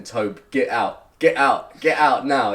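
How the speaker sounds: rising alarm in his head; inner alarm. Retold or imitated. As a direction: inner voice yelling to escape. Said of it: "alarm bells going off saying".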